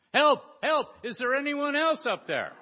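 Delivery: high, loud desperate yell upward; yelling for help. Acted. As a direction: shout upward in panic. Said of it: "and then Jack said".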